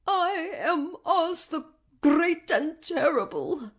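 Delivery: put-on high, slow, quavering little old man; trembling. A narrator's reading. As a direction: draw it out timidly, trying to sound grand. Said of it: "said the man in a trembling voice".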